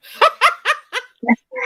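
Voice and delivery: high, loud burst of laughter; big laugh. Real, not acted. Host cracking up at a viewer's joke, loud laugh.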